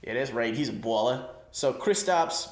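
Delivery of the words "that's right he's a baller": ordinary talk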